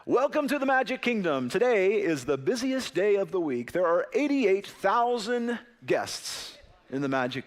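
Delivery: high, bright park announcer over a PA; loudspeaker announcement. Retold or imitated. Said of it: "they came over the loudspeaker".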